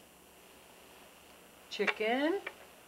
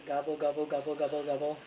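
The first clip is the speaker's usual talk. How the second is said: turkey gobble; 8 semitones lower than usual. Shown as a demonstration. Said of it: "Turkey says".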